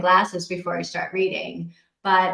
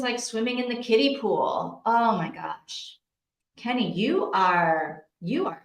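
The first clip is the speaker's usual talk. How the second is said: ordinary talk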